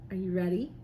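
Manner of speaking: ordinary talk, nothing marked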